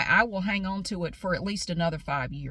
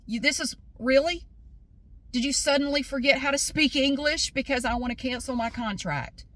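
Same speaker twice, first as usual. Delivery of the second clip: high-pitched, incredulous challenge; indignant; 5 semitones higher than usual. Retold or imitated. Customer confronting staff who forgot English, indignant. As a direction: call them out in disbelief, easing down at the end.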